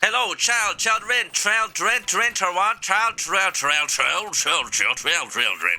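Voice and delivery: high looping stutter, winding down; malfunctioning AI stuck on repeat; glitching robot voice. Acted. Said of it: "some weird connection problems".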